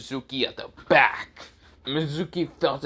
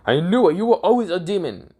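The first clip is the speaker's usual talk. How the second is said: alarmed accusation; 8 semitones higher, faster than usual. Acted. Mizuki facing fire spears accuses Naruto, alarmed accusation. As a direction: cry it out, pitched well up.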